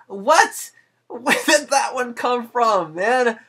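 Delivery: sudden high, loud surprised shout. Real, not acted. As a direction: blurt it out in disbelief. Sudden surprised outburst at chat.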